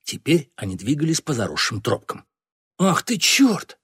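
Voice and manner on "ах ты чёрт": high, drawn-out exclamation of mock outrage; feigned angry amazement. A narrator's reading. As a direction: cry out as if furious and astonished. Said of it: "воскликнул Лебрак, ... изобразив гнев и изумление". (наигранный гнев и изумление)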